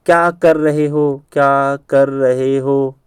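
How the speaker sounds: slow, stretched "क्या कर रहे हो"; word by word. Shown as a demonstration. Teacher showing how texters shorten it, word by word. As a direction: draw out each word so every initial stands out. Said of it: "ऐसे short में के के आर एच".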